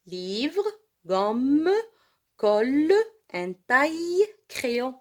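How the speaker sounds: ordinary delivery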